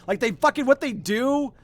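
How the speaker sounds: high, loud ranting fury; real heated rant about a film romance; nerd rage. Real, not acted. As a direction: rant it like a furious fan. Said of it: "like, an actual, like, nerd rage".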